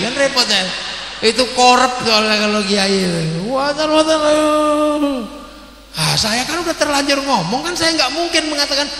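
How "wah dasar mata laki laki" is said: wife's reproach. Retold or imitated.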